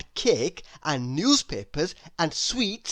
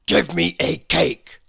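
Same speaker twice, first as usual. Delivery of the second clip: louder gruff character bark; snapping demand; 6 dB louder than usual. Acted. Mister Grumpy snapping at the cake seller. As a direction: bark the order at the shopkeeper. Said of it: "snapped Mister Grumpy".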